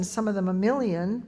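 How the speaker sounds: usual speaking voice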